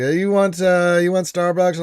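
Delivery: lightly voiced partner asking; wife offering coffee. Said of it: "she's like".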